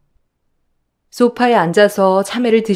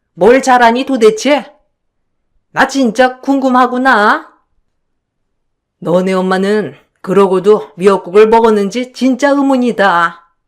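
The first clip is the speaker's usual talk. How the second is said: put-on nagging, sneering older woman, louder; scolding mother-in-law; 2 semitones higher, 4 dB louder than usual. Mother-in-law berating her daughter-in-law while eating melon, sneering. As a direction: belittle her as you boss her around.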